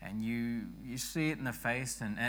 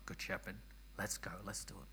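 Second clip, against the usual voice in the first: whisper; 4 semitones lower, 12 dB quieter, faster than usual. Real, not acted. Hushed cheer after coughing.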